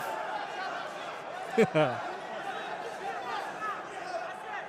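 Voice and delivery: high airy laugh. Real, not acted. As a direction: burst out laughing. Commentator laughs at fighter.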